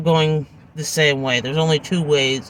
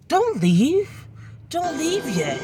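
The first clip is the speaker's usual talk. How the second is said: guy pleading; 10 semitones higher, 3 dB quieter, slower than usual. Retold or imitated. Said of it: "And the guy goes".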